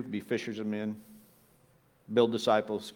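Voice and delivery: ordinary talk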